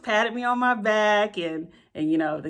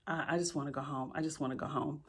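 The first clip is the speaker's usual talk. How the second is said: faint, worn-out mumble, much softer; weary whimper; 5 semitones lower, 13 dB quieter, faster than usual. Retold or imitated. Teacher on the hike, wanting home: soft, defeated murmur. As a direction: barely get the words out, done in. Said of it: "I was like".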